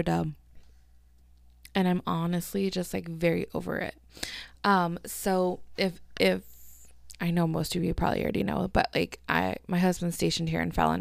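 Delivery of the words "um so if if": ordinary talk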